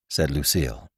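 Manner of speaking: usual speaking voice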